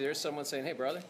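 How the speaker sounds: normal talking voice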